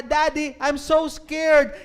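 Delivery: high, loud, slow frightened cry; a terrified child calling for her daddy; scared little girl. Acted. Trapped girl tells her father she is afraid, crying out high. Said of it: "But the little girl said".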